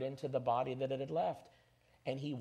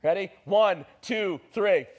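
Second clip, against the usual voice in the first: loud, raised coaching call; rallying count-in; 6 semitones higher, 11 dB louder, slower than usual. Real, not acted. Preacher cueing the congregation to shout, rallying count-in. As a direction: count the crowd in loudly, building to the cue.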